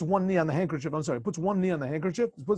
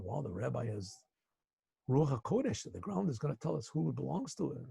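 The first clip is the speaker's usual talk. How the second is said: awed hush; 3 semitones lower, 9 dB quieter than usual. Retold or imitated. Men awed by the rabbi.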